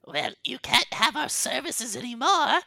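high, loud crone huffing; indignant hag. Acted. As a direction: huff it out as an offended hag. Hag refusing services.

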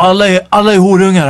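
mock public outburst; retold yelling. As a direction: imitate a man yelling out at everyone. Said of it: "skrek rätt ut".

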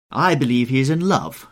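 loud, high hostess's exclamation; crying out. A narrator's reading. Hostess teasing a guest about love, crying out. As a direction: announce it loudly to the table. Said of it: "cried Lady Narborough".

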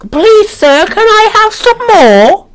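high-pitched Cockney orphan voice; hammed-up high Cockney begging; Cockney Oliver Twist. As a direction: put on a squeaky, pleading Cockney urchin. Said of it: "with a Cockney Oliver Twist accent".

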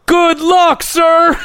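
high, mock-formal farewell; security guard teasing the friend, high and mock-polite; mock security guard send-off. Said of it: "our security guy goes".

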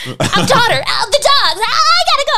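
frantic mom voice. Pretend. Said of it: "clueless dad".

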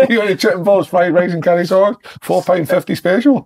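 high, loud hammed-up takeaway sales patter; mock takeaway server. Mocking the Chinese takeaway's special offer, loud and high.

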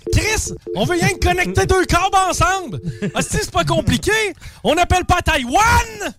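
exasperated rant. Real, not acted. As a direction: shout at the useless phone system. Ranting at phone message.

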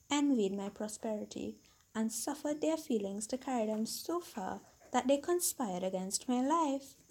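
measured storybook reading voice; story narration. A narrator's reading. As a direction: read it like a classic tale. Reads a fairy tale aloud.

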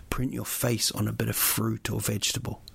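ordinary delivery

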